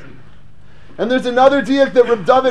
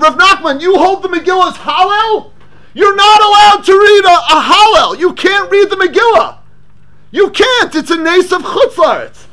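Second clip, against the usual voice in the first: higher, insistent, building protest; indignant challenge; 7 semitones higher than usual. Acted. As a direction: press the point as if outraged. Voicing the Gemara's indignant question.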